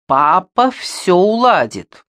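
breezy stock answer. A narrator's reading. Rebecca's answer to every life problem, breezily mimicked. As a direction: mimic a pat answer, drawn out.

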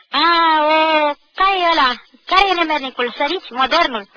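rally the gang against the culprit; rowdy yell. Acted. Boys charging over benches hunting the insulter, rowdy yell.

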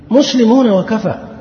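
high, slow mock rallying slogan. Preacher quotes those blurring sects, high mock proclamation.

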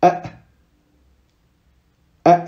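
a cut off sharply in the throat like a hiccup; Arabic hamza with sukun, clipped like a hiccup. Shown as a demonstration. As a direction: stop the a with a glottal catch, as if hiccuping. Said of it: "comme si on avait le hoquet".

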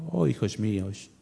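low, soft, syrupy Spanish-dubbed Jesus; Jesús de película, voz muy suavecita y melosa; mock soft movie Christ. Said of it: "cuando ponen a Cristo ahí y habla muy suavecito".

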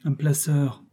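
plain reading voice

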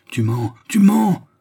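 cri aigu, très haut et fort, lent; glapissement. A narrator's reading. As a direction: glapir d'une voix perçante, en traînant. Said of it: "glapit la juive". (glapissement furieux, aigu et lent)

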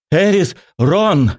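breathless high shout, syllable by syllable; gasping shout by syllables. Acted. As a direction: yell the name out of breath, broken in two. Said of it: "по слогам задыхаясь, выкрикнула она".

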